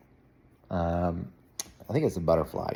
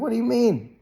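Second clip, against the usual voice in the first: puzzled. Retold or imitated. Dad puzzled by son's reaction.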